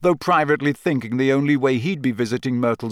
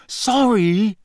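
high, exasperated apology; raised, irritated voice; impatient; 9 semitones higher, 4 dB louder than usual. A narrator's reading. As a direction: toss out a tetchy apology. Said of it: "he said impatiently".

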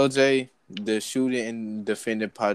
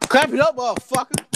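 shouting; 13 semitones higher, 7 dB louder than usual. Real, not acted. Hyping the crowd, calling out.

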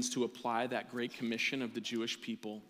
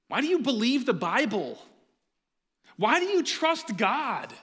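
put-on louder and higher, a challenging teen whine; cynical teenager; 7 semitones higher, 7 dB louder than usual. Pastor imitating his teenage self questioning faith, cynical and loud. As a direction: push back like a doubting teen. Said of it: "my own cynical voice as a teenager".

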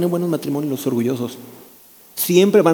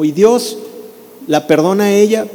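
usual speaking voice

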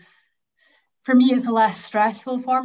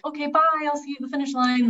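quick, high, breezy send-off; breezy goodbye; 6 semitones higher, faster than usual. Retold or imitated. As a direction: toss it off lightly, waving them away. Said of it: "I would never be like".

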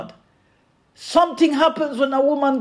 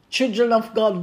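ordinary delivery

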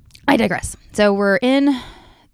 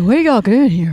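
louder slurring party critter; drunk hamster; 4 semitones higher, 6 dB louder than usual. Retold or imitated. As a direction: voice the tipsy hamster.

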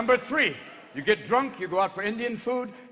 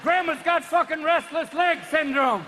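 high, loud comic alarm; frantic mock shouting; 8 semitones higher, 4 dB louder, slower than usual. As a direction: cry out as if your legs dance away. Acting out a Riverdance fit.

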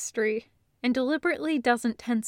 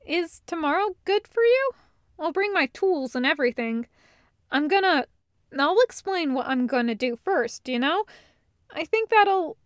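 higher, eager, slightly flustered planning; Hallwinter, eager; 6 semitones higher, 3 dB louder than usual. Acted. Hallwinter planning tomorrow's arm check, eager and flustered. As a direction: rush to reassure and plan.

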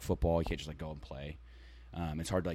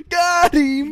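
laughing shout; 14 dB louder than usual. Real, not acted. Laughing shout after prank.

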